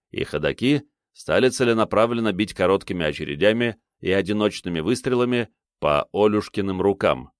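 ordinary delivery, nothing marked

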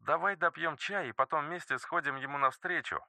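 put-on soft, high child voice; a calm little boy reassuring; small boy voice. A narrator's reading.

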